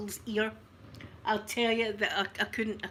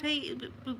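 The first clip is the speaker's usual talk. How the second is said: ordinary talk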